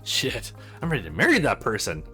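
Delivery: ordinary delivery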